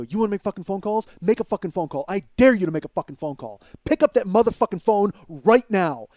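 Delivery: heated challenge building to a loud command; retold angry dare. Man daring her to pick up the phone, building anger. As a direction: hammer each dare harder, ending loud.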